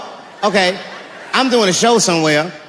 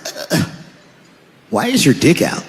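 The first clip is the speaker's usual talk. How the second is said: lightly voiced dying addict, dumb and confused; just a hint of a dazed junkie; mild dopey dying voice; dopey last words; 4 semitones lower than usual. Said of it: "their last words are always the dumbest words".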